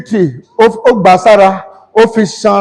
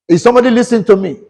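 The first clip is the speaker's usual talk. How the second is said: rapid preacher's call; much faster than usual. Real, not acted.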